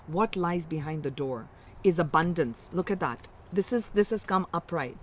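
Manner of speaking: ordinary delivery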